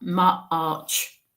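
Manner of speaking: MARCH slowly split in two, mar-arch; March, drawn out and broken midway. Retold or imitated. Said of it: "she's breaking it down for me to understand".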